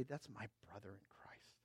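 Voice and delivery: hushed whisper, almost no voice. Acted. Pastor pointing to his friend in the congregation, hushed whisper. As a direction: breathe it like a secret to the front row.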